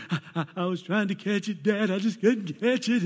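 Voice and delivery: high, loud, stammering excuse; a distraught teen pleading her case; upset daughter. Retold or imitated. Daughter explaining to dad, high and upset. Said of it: "Sadie was like".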